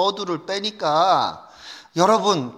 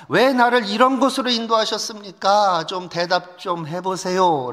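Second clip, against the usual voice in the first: raised, louder pleading protest to God; complaining prayer; 5 semitones higher, 5 dB louder than usual. Acted. Preacher shows youths how to talk to God, complaining. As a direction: demand answers from the Lord in exasperation. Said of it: "그분처럼 하시라고요".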